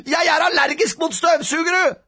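put-on high, loud child's wail; howled protest. A narrator's reading. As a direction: howl the excuse out. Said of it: "hylte rampete Robin".

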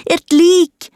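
high, alarmed call; alarmed shout. A narrator's reading. Child has found a body, high alarmed shout. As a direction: call out the shocking find. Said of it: "ropte jeg".

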